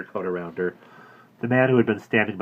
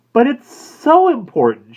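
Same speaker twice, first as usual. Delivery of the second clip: high, slow pleading; begging him to help; anxious plea; 13 dB louder, slower than usual. A narrator's reading. Woman pleading with the florist, high and slow.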